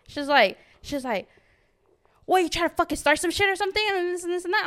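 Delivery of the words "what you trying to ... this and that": fast mock hostile challenge. Quoting hostile girl.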